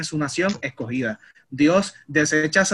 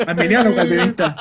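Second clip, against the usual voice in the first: mock angel question; 5 dB louder than usual. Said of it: "un ángel como que".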